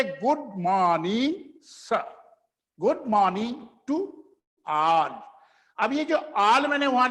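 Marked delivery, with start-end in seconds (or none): 0.0-5.1 Good morning, sir, good morning to all, drawn out slowly; slow model phrase. Shown as a demonstration. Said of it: "तो इसलिए". (धीरे-धीरे बोलकर सिखाना)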